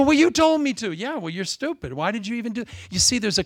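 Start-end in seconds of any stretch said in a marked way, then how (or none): 0.0-0.9 protesting. Retold or imitated. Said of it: "You go". 0.9-2.6 devil's sneer. Retold or imitated. Devil mocks the listener.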